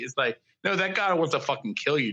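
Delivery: ordinary delivery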